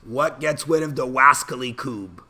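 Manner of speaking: high cartoon hunter voice turning r into w; lisping cartoon hunter, hammed up; Elmer Fudd impression. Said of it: "I am doing an Elmer Fudd impression".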